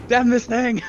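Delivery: laughing while cursing; laughing curse. Real, not acted. Player laughing at the monster.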